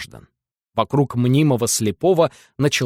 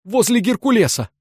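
shout; 10 semitones higher than usual. A narrator's reading. Balaganov keeps shouting.

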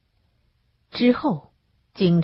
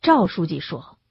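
ordinary delivery

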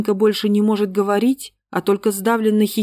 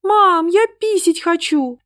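put-on high, slow whiny child; a small girl's whiny demand; capricious little voice; 10 semitones higher, 4 dB louder than usual. A narrator's reading. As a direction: demand it like a spoiled little girl. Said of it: "капризным, требовательным голосочком".

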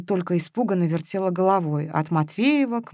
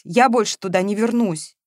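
plain reading voice

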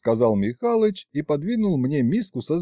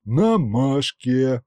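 ordinary delivery

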